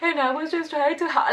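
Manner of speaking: weepy begging to the dean, acted to get off easy; fake crying plea. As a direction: sob it out to sound believable. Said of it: "I was just crying, like, trying to make it, like, believable".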